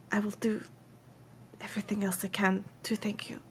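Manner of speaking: hushed, halting offer; very soft, breaking the offer into small pieces; earnest quest giver. Acted. Woman offering coin for a rescue, hushed and halting.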